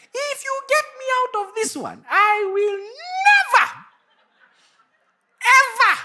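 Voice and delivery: high pleading vow, NEVER pushed loud; begging God with an overblown promise; desperate vow. Retold or imitated. Preacher reenacting his desperate vow, high and pleading. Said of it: "you know those prayers".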